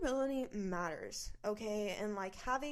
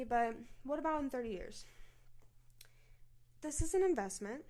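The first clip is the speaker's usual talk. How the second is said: ordinary talk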